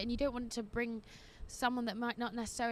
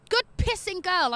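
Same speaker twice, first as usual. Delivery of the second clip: higher, louder, slow cheer; proud cheer; 7 semitones higher, 7 dB louder than usual. Real, not acted. Host cheering a reader's self-confidence, proud and loud. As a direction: cheer her on like a proud friend.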